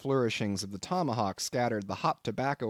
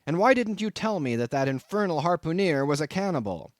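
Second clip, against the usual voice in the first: 1 angry; 5 semitones higher, 4 dB louder than usual. A narrator's reading. Angry guest continues shouting.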